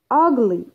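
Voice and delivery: "Ugly" with a strong "Ug"; stress on first syllable. Shown as a demonstration. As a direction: punch "Ug" in "Ugly", let "ly" fall away. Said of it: "stress يجي on the first syllable".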